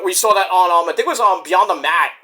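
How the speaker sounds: ordinary delivery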